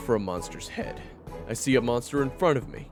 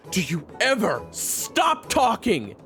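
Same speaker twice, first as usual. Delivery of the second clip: exasperated yell; 10 semitones lower than usual. Acted. Fighter fed up with his chattering rival, exasperated yell. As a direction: burst out, dragging each word in exasperation.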